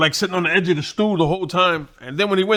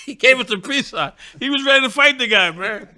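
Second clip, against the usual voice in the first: laughing storytelling; 8 semitones higher, 3 dB louder than usual. Real, not acted. Laughing retelling punchline.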